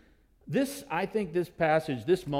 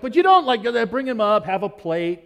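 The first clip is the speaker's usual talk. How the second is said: loud, high mock protest; mock outrage; 4 semitones higher, 10 dB louder than usual. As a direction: act scandalised, playfully. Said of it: "I'm like".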